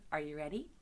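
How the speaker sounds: ordinary delivery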